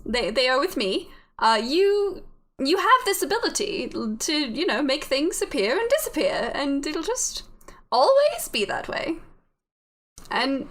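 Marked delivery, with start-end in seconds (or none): 0.0-9.2 higher, swooping put-on British reassurance; bad British accent. Voice in the head reassures the confused character, lilting British accent. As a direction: soothe them in a hammy, lilting British voice. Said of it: "My horrible British accent".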